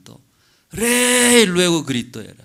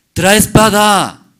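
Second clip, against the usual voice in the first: mandato lento, fuerte y muy agudo; orden del rey; 8 semitones higher, 12 dB louder than usual. Acted. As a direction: manda traer la espada sin réplica. (orden real fuerte y lenta)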